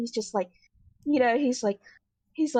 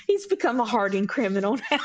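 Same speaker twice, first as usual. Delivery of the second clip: ordinary talk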